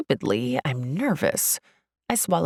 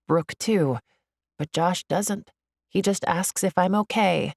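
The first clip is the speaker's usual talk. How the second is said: ordinary delivery, nothing marked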